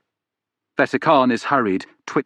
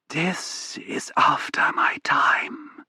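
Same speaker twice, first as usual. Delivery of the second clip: slow, higher whisper, "this" lifted with an odd rising tune; rasping whisper; 7 semitones higher, much slower than usual. Acted. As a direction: breathe "This is after my time" like dust, dragging each word out. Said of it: "Her voice is like dust, just a rasp and a weird intonation".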